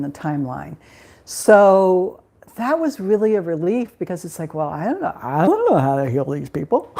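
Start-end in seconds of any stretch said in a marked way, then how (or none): 4.5-6.9 low, baffled protest with a sudden swoop; mock helplessness. Healer retells her reaction to the task, mock helpless. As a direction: play up being clueless, pitched low.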